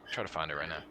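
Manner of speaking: usual speaking voice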